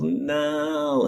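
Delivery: high mock wail of a baby; drawn-out, high whine mimicking a crying baby; imitated baby crying. Baby refusing to leave the man holding him, imitated crying. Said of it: "and starts crying".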